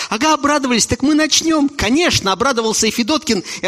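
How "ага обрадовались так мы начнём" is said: high eager locals. Acted.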